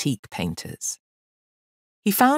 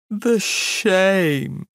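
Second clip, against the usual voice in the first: loud, higher wail of humiliation; lamenting elephant; 6 semitones higher, 4 dB louder than usual. Acted. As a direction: bemoan it as a wounded great beast. Humiliated elephant laments his state.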